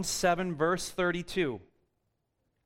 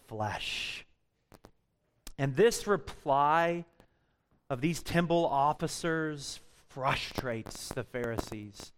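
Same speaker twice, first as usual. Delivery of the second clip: ordinary delivery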